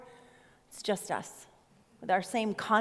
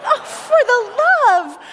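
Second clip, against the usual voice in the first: much higher and louder cry of exasperation; exasperated; 12 semitones higher, 11 dB louder than usual. Retold or imitated. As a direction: throw up your hands, oh for the love. Mother exasperated by daughter's tears.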